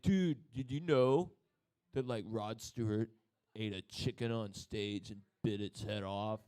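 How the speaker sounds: slow dumb-metalhead voice; dumb guy. Acted. Dumb metalhead passing on a rock rumour, slow dim voice. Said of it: "that dumb guy kinda like".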